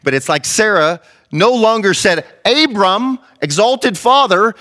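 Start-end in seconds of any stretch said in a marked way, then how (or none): 2.4-4.5 high-pitched supper call; acted calling out. Sarah calling Abram in for supper, calling out.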